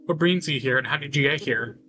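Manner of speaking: usual speaking voice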